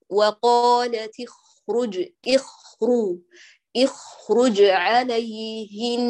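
very slow وقالت اخرج عليهن with a moderate خ; balanced kha recitation. Shown as a demonstration. Teacher reciting the correct model for the student, very slow. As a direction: recite اخرج evenly, neither airy nor forced. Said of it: "فتقولي هنا".

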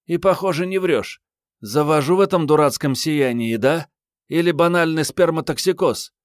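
put-on high, forceful girl's voice; teasing young woman. A narrator's reading. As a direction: needle him in a high voice. (высокий насмешливый девичий голос)

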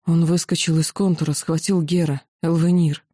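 plain reading voice

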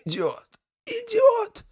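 very high wheezing voice. A narrator's reading. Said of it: "просипел мессир Архимаг".

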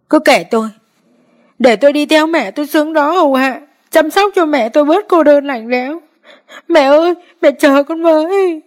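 high, anguished wail. A narrator's reading. Hồng refusing comfort at the funeral, high anguished wail. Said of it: "càng khóc lớn hơn".

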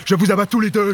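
threatening command. Acted. Threatening man holding gun.

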